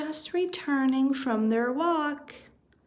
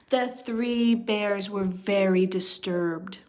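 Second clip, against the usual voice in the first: ordinary delivery, nothing marked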